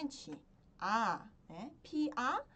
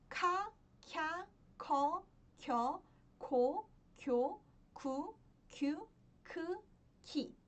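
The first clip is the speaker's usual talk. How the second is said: each syllable recited apart, with a lifted tune; syllable drill; 2 semitones higher, much slower than usual. Shown as a demonstration. Korean teacher modelling the consonant K with each vowel, syllable drill. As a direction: recite the table syllable by syllable, evenly.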